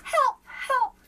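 high, loud panicked yelling; frantic cry for help. A narrator's reading. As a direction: shout for help in alarm. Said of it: "cried the page".

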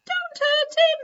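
very high, tiny squeaky plea; little mouse voice. Acted. Mouse begging Kipper not to hurt Sock Thing, tiny high voice. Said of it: "a little voice said".

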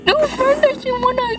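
high frantic whimpering pleas; scared near tears. Real, not acted. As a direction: beg in a panicky teary voice. I'm gonna cry!.

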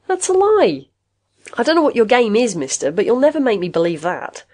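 high-pitched, heated protest; indignant denial. A narrator's reading. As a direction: defend your best friend against a slander. Friend indignantly denies a murder accusation.

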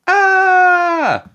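high loud startled yell; sudden loud high scream; boys' yell. A narrator's reading. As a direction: let out a loud high shriek of fright. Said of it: "yelled the boys".

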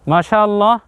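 ordinary delivery

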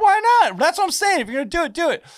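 very high, loud, excited squeal; gleeful egging-on. Retold or imitated. Man cheering on his own wild decision, giddily excited. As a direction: talk it up in a giddy high voice. Retells thrilled resolve to go ahead.

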